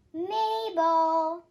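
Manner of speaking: mom's firm call up the stairs; business voice. Retold or imitated. Mom calls Mabel up the stairs in a brisk business voice. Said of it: "in her, I mean, business voice".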